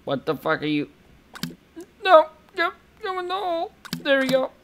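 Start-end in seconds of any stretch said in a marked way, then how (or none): 2.0-4.5 very high, tiny cartoon voice; high-pitched cartoon voice as it drops in the hole; squeaky little voice. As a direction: squeak it like a little critter being pushed in. Voicing a cooking game object.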